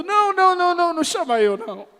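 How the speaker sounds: high, fast mock-panicked no no no; panicked refusal. Jeremiah pleading with God not to call him, hammed-up panic. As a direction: beg your way out of it, hammed-up.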